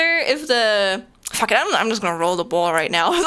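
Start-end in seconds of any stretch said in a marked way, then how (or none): none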